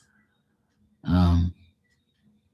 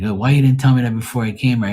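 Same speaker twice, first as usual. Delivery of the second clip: fast, higher, louder mock complaint; mild retold exasperation; 5 semitones higher, 5 dB louder, faster than usual. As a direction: replay a mildly exasperated protest to dad. Retells his exasperated reply to dad.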